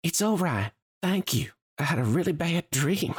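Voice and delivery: put-on high, gentle young oliphant voice; a shaken girl calming down; Lulu the young oliphant. Lulu reassuring Corbin after her nightmare, high gentle voice. Lulu's voice, held figure.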